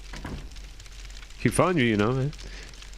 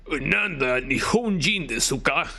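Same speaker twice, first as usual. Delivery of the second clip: slow, deliberate Japanese, rising on Nihonjin; Japanese accent; slower than usual. Acted. Player voicing a Japanese cop in a Japanese accent. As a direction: say "Nanda Nihonjin desu ka?" slowly with a Japanese lilt, rising at the end. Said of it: "should I do a Japanese accent for this guy?".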